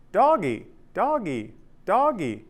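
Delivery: put-on high, falling toddler call; imitating three-year-old daughter. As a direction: imitate a little girl calling out. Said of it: "I just kept hearing her say".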